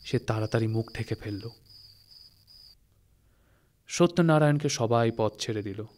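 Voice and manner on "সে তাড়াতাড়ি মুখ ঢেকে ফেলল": normal talking voice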